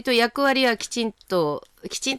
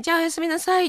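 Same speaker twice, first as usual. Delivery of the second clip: 高めの声でおやすみの挨拶をまねる; 声を高くして軽く再現; 就寝の挨拶の再現; 9 semitones higher, faster than usual. Retold or imitated. 寝る前の挨拶を再現. (高めの声でおやすみの挨拶)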